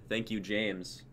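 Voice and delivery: usual speaking voice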